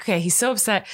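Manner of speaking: high-pitched soothing parent; gentle parenting. Retold or imitated. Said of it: "trying my gentle parenting".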